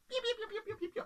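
very high, soft little peeping chicks; chick peeps. Acted. Little chicks peeping as they wake, high and soft. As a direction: peep like tiny chicks waking up.

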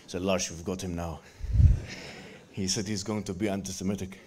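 low, hushed secret service agent; mock agent with an odd accent, pitched right down. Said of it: "he's got this kind of accent".

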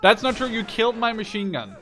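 high, loud indignant denial; loud protest. Real, not acted. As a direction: shout down the accusation. Heated protest in game argument.

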